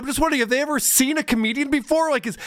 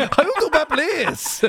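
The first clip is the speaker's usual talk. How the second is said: high faux-Chinese accent, breaking into laughter; mock Chinese accent; 7 semitones higher than usual. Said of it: "Now, now she's fucking Chinese".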